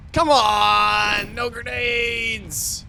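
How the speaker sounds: drawn-out, exasperated yell; frustrated shout. Real, not acted. Frustrated at finding no grenades.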